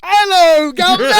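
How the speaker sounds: put-on over-the-top British accent; hammed-up British accent. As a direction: belt it out like a cartoon Englishman. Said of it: "talk like that the rest of the episode".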